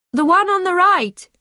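high, pressured cry with contained volume; urgent call. A narrator's reading. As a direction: call out the answer under pressure, pitched high. Pupil still shouting under pressure.

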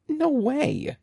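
high-pitched, mildly breathless protest; breathless cry. A narrator's reading. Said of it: "Andy cried breathlessly".

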